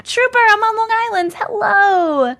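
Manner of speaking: high, loud sung hello; singsong greeting. Real, not acted. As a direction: call out to a friend cheerfully. Greeting a viewer in chat.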